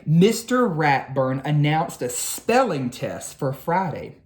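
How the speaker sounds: ordinary delivery, nothing marked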